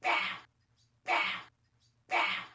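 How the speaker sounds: loud bam sound effect; loud mock crash noise; imitated crash. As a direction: slam it out like a thud. Bird crashing in cage.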